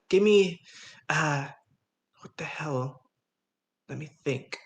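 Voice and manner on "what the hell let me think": whispered aside. Real, not acted. Player muttering to herself.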